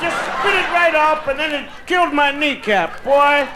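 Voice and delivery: high, excited pitchman voice with a shouted bit; hammed-up testimonial. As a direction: sell the miracle cure like a TV ad. Opening ad-style testimonial voice.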